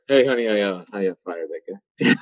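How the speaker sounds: low, hesitant admission, trailing down; mock sheepish husband owning up haltingly; sheepish confession. Husband admits to his wife a week later, sheepish.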